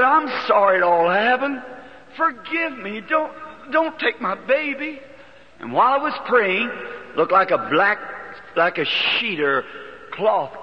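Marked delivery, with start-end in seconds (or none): 0.0-5.0 retold desperate plea. Begging God for baby.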